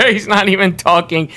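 laughing through the words. Real, not acted. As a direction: crack up while saying it. Laughing at a silent character.

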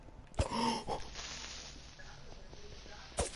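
sharp high gasp of fright; terrified gasp. Real, not acted. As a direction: gasp in sudden terror. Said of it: "That was actually terrifying".

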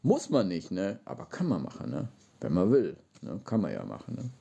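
normal talking voice

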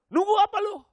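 high, loud demanding father; gruff father voice. Retold or imitated. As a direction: bark the question like a stern dad. Strict father questioning son.

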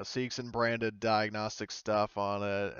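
normal talking voice

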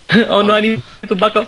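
high, mock Indian accent. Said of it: "You're supposed to say it with an Indian accent".

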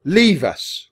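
loud, high princely command; shouted, imperious order; roar. A narrator's reading. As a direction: roar the dismissal. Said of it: "roared Alexander".

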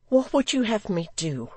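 high, pleading woman's voice; distressed widow. A narrator's reading. Distressed widow answers the threatening visitor.

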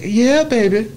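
high-pitched, drawn-out funky greeting; lightly voiced funk-star call; imitated musician's catchphrase. Imitating the voice heard down the corridor.

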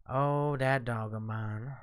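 ordinary talk, nothing marked

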